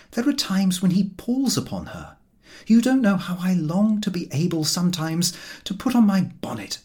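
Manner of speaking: a hint of an exasperated wife; wife airing grievances. A narrator's reading. Ethelberta voiced by narrator.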